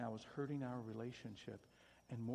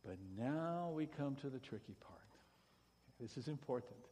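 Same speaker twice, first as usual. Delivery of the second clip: usual speaking voice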